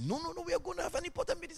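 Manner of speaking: high, quick, soft mimicry; refusing boss lady. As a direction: imitate a boss fussily brushing off a request.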